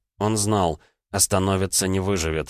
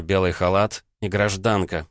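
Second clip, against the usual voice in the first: ordinary delivery, nothing marked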